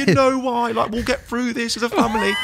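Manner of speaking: high, tearful mum voice imitated; mum in tears. Son retelling his crying mum's phone call, tearful and high. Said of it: "she's in floods of tears".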